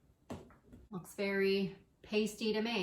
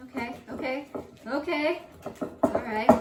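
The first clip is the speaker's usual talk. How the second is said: higher, louder okays; rising relief; 4 semitones higher, 5 dB louder than usual. Real, not acted. Baker relieved the custard did not scramble. Relief building after tempering.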